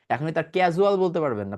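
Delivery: ordinary talk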